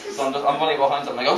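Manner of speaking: usual speaking voice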